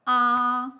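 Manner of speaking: "a" held loud, high and level. Shown as a demonstration. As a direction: hold "a" steady up at a high pitch. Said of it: "high level tone".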